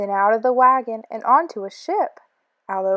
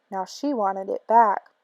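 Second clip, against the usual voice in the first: ordinary delivery, nothing marked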